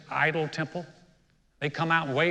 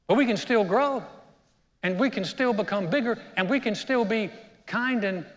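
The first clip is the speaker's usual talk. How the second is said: rising preacher's appeal; 4 semitones higher than usual. Acted. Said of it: "preaching climax".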